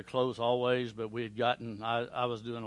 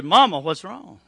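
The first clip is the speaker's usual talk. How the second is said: alarmed call; 6 semitones higher, 14 dB louder, slower than usual. Retold or imitated. Son rushing in to weeping mother.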